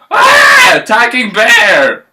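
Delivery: very high shriek then loud cry; screaming fright. Acted. Goldilocks waking up to the bears, shrieking. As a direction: scream in terror.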